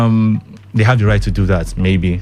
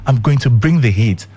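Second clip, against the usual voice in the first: ordinary talk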